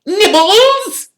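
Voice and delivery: high, loud, mock-sweet offer; sweet Peeves. A narrator's reading. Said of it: "he said sweetly".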